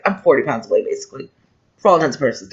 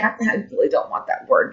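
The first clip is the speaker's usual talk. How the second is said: breathy whispered aside, softer and unvoiced; 3 semitones higher, 7 dB quieter than usual. Real, not acted. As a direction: drop to a whisper as if it's a secret. Aside about becoming a grandmother.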